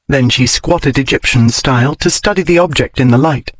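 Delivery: ordinary delivery, nothing marked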